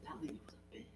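barely audible, voiceless whisper; whispered aside. Retold or imitated. Manager confiding about a departed customer, whispered aside. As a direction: re-enact a confiding whisper under the breath.

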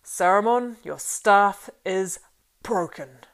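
slow, loud pronouncement; clear, cold voice. A narrator's reading. Gandalf breaking Saruman's staff, slow, clear and cold. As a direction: pass sentence slowly and coldly, word by word. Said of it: "spoke slowly in a clear, cold voice".

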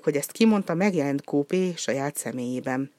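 ordinary delivery

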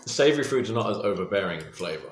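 usual speaking voice

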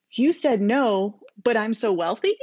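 ordinary delivery

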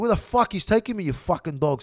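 loud mock outburst, easing off; swearing at someone. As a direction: imitate cussing out a captor. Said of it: "Swearing at him".

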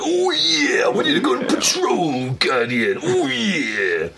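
high raspy wrestler growl; Macho Man voice. Pretend. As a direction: ham up a Macho Man promo. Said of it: "Zavala changed into the Macho Man Randy Savage".

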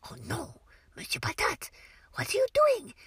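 hushed, pretend-horrified gasp at the puppet; mock shocked whisper. Shown as a demonstration. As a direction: whisper it like you've just spotted a disaster. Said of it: "be shocked".

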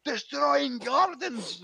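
angry old gardener scolding a tourist, hammed-up shout; mock Scottish yell. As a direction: bellow it like a furious old Scot, drawing out the last words slowly. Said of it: "started shouting at me in Scottish".

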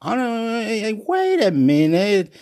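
high-pitched indignant plea; acted high, baffled complaint; pleading protest. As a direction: protest upward to the sky. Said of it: "And I say".

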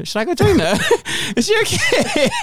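high, laughing, inviting voice; high laughing invite. Retold or imitated. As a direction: laugh through a cheery invitation. Acting out inviting a stranger.

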